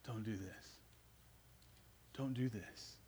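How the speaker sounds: hushed, understated plea; pleading. Retold or imitated. Joseph pleading with his brothers for his life, quiet pleading. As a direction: plead softly and low, almost under the breath. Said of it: "he is pleading for his life".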